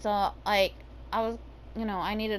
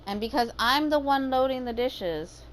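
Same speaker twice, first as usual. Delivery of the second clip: ordinary delivery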